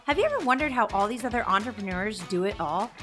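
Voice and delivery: polished announcer read over music; podcast intro read. Acted. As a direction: read it like a show opener.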